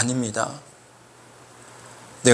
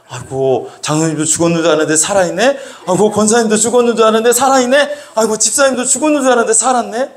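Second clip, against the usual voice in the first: fast, rising disbelief, higher each time; mock astonishment; 5 semitones higher, 4 dB louder, faster than usual. Preacher finds the supposedly dead elders alive, mock astonishment. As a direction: marvel in a quick, climbing voice.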